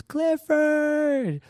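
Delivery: put-on very high, drawn-out kid's squeal; joyful scream. Excited kid seeing the mascot, high scream. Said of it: "he just screams".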